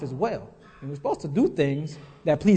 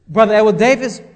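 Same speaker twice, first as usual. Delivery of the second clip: name called out louder; stern self-summons, voice raised; 2 semitones higher, 8 dB louder, faster than usual. Retold or imitated. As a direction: call your own name out like a rebuke. Calling himself by name, repeated.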